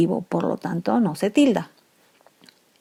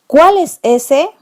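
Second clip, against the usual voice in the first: high, loud, slow amazed cry; exclaimed; 8 semitones higher, 7 dB louder, slower than usual. Shown as a demonstration. Teacher modelling an exclamative cuál, astonished. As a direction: exclaim it in surprise. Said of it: "Sería exclamativo".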